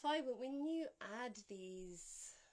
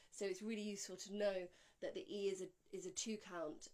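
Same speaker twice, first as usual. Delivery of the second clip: usual speaking voice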